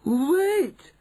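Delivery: high, loud witch's shriek. Acted. Witch in back row stops everyone, shrieking. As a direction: shriek it to halt the whole room. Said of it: "shrieked one of the witches".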